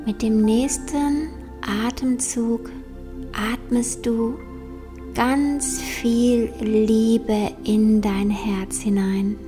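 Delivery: slow, soft meditation voice; guided meditation. Acted. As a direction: guide them gently into calm, slowly.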